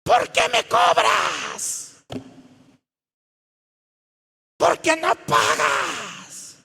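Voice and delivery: high, loud, hammed-up yelling match; shouted quarrel. Church members reproaching each other in the kitchen, yelling. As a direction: stretch the accusations out in a mock screaming fight. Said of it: "en la cocina reclamándose".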